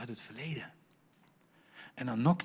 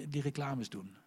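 ordinary talk

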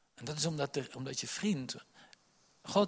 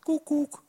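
high, loud comic bonk noise, much higher; mock bonk sound; 7 dB louder than usual. Preacher telling David and Goliath to children, a high comic bonk. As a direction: squeak out the stone's impact like a cartoon.

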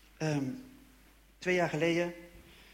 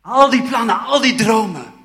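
much higher and louder, ringing out; passionate proclamation; 6 semitones higher, 16 dB louder than usual. Real, not acted. Speaker tells audience his dreams returned, ringing passionate cry. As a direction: proclaim it like a preacher.

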